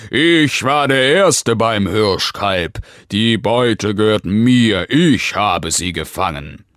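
angry, loud claim of the prey; loud, indignant bear in a rage; furious snarl. A narrator's reading. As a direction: snap it furiously at a rival. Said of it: "fauchte ihn wütend an".

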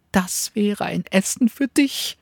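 high, fast, bragging dog voice; sly little dog. Acted. Cunning little dog tempts the wolf, high and eager. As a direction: tempt him with a tiny eager voice.

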